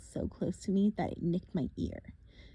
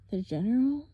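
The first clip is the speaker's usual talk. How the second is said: put-on lightly French-accented question; 4 semitones higher, 5 dB louder than usual. Boy blurting a question in a light French accent. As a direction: ask it like a Frenchman. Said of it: "I had said it with a French accent".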